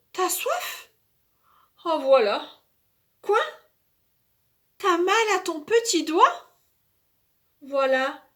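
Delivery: put-on tender child voice. Child cares for toy.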